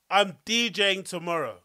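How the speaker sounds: ordinary talk, nothing marked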